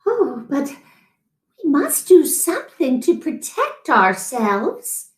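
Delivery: a hint of a pleading little wife; lightly voiced anxious wife. A narrator's reading. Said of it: "said his wife".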